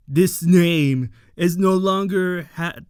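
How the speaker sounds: put-on loud, fast and hard, renouncing her old name; cold loud declaration. Rita coldly rejecting her old name to Baku, loud and fast. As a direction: throw the old name away loudly. Rita's turn, declaring new identity.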